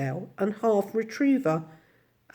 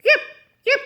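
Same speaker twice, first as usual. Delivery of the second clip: short, high yaps; little dog's bark; 6 dB louder than usual. A narrator's reading. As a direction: yap like a small dog. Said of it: "Andrew barked again".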